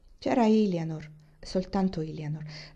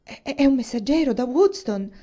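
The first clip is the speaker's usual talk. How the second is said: louder, higher stammered exclamation; stammering alarm; 5 semitones higher, 7 dB louder than usual. A narrator's reading. Catherine, gone pale, stammers her fear aloud. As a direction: blurt it out, stumbling in fear. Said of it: "esclamò".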